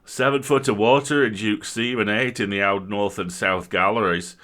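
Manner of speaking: big miner growls a report; rough spokesman, growled and loud; growling miner. Acted. Miner spokesman reports the flood to the squire, loud growl. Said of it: "growled a huge red-headed fellow".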